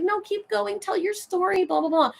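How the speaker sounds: high-pitched mimicry of eager fans; fawning commenters. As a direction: imitate adoring women cheering him on. Mimics admiring women in comments.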